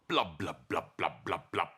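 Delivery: blub like bubbles rising; bubbly blubs. Acted. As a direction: underwater bubble noises. Bubbles underwater.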